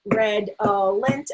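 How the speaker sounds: redolent chanted slowly in three beats; slow, evenly separated syllables; tapped-out syllables. Shown as a demonstration. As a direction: tap out red-ol-ent each time. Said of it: "tap the syllables".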